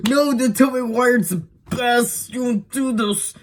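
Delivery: high, hammed-up fan protest; mock protesting fans. Most people defending Tobey Maguire after the recast, high mock outrage. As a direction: imitate upset fans, pitched well up. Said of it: "most people went".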